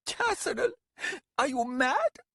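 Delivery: high, pleading distress; near tears. Acted. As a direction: cry out close to tears. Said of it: "Lasaruk was near tears".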